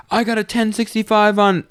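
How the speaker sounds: high, urgent mock police call; cop radioing in. Roleplaying cop reporting after shooting the driver, urgent radio call. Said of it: "he tells the road".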